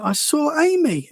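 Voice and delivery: put-on high, slow small child's voice; a little boy reporting what he saw; imitating his son. Dad retelling his son's words: high, slow child's voice. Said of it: "he went".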